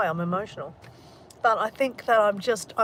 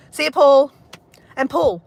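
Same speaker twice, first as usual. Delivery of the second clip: call out; 6 semitones higher, 11 dB louder than usual. Real, not acted. Calling out goodbye.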